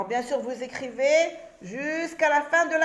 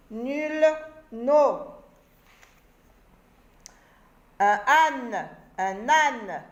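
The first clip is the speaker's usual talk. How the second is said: lecture scandée; much slower than usual. Shown as a demonstration. Lecture de mots.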